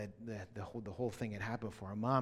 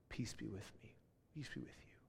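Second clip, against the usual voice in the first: whispered blessing; 11 dB quieter than usual. Retold or imitated. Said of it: "someone was saying to me".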